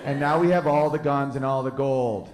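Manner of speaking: usual speaking voice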